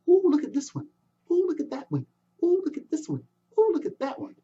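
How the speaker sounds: put-on a child marvelling at shells; young boy.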